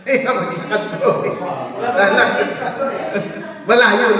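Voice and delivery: laughing through the words. Real, not acted. Laughing while joking to the congregation about the long walk. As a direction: chuckle the joke out. Joke about walking to heaven.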